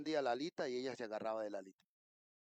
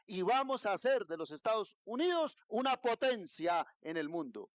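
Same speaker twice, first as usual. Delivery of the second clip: higher, louder preacher cry; full-force preaching; 6 semitones higher, 5 dB louder than usual. Acted. Pastor stirring the congregation, preaching loud and high. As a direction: lift the congregation, voice ringing.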